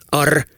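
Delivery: letter "R" said with a rolling trill; rolled R. Shown as a demonstration. Imitating the narrator spelling P R A Y, "R" rolled. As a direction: spell out "R" and roll it. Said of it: "he always rolls that R just there".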